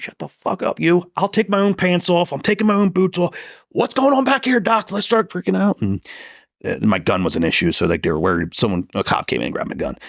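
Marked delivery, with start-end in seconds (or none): 0.0-5.8 retold high-pitched frantic demands; agitated mock yelling. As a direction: re-enact an amped-up patient bossing the staff. Retold yelling at nurse and doctor.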